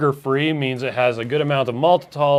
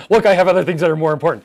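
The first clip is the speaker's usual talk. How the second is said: laughing brush-off; 4 semitones higher, 7 dB louder, much faster than usual. Retold or imitated. Acting out brushing off caller.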